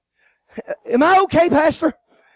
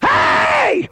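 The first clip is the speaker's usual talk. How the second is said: mock scream. Jesus imagined calling out over the gunshot, a high shout. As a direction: yell it like calling over gunfire. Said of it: "He never screamed to me one time".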